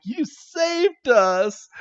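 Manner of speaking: high, drawn-out mock gushing; acted grateful cry. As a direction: retell it as a high, stretched-out cry of relief. Ash thanks rescuer in retold scene.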